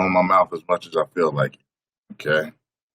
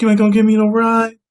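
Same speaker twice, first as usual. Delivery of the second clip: much higher, louder, fast and outraged; indignant high voice; 5 dB louder, faster than usual. Retold or imitated. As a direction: squeal it out in disbelief. Voicing woman indignant.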